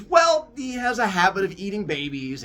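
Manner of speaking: starts high and loud, settling as it goes; high defensive start, then easing down; awkward explaining. Acted. Grandma explaining to shocked grandson, high and defensive.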